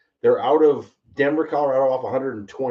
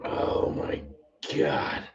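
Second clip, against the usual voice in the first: voiceless rasp after the burn; throat burnt, barely any voice; lost voice; 10 semitones lower, 6 dB quieter, much slower than usual. Real, not acted. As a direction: whisper it, voice gone from the liquor. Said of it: "I think I lost my voice".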